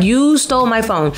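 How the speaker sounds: jab the accusation right at him; furious repeated accusation; angry accusation. Retold or imitated.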